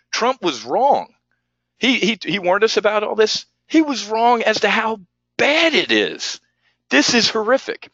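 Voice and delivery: ranting about betrayal, voice rising; furious rant. Real, not acted. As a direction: pitch climbing high in outrage. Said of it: "I gotta calm down and rant".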